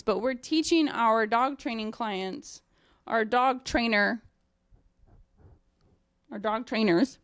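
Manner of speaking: normal talking voice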